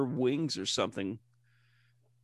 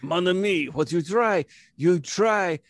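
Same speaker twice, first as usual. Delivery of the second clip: put-on louder, gushing French accent; effusive French waiter, hammed-up; 3 semitones higher, 5 dB louder than usual. Urging a friend to try lemongrass, French accent. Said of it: "That's my French accent".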